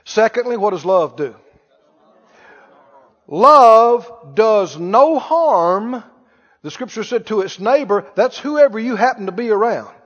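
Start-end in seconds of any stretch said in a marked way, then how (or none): 3.3-6.0 very slow, each word drawn out; drawn-out preaching emphasis. Acted. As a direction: lay it down slowly like a verse. Preacher drawing out key phrase.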